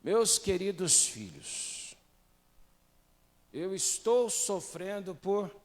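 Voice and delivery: slow solemn verse reading; solemn scripture reading. Shown as a demonstration. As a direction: read it out slowly like holy text. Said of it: "Posso ler?".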